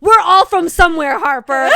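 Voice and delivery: mock outburst, belted at Harper; retold outburst. Colin snapping back at Harper after dropping his accent, loud retort. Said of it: "he drops his accent and is like".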